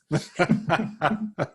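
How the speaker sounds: real laugh at the punchline; hearty laugh. Real, not acted. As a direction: let the laugh roll out. Laughs at own story punchline.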